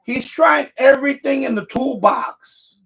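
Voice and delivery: usual speaking voice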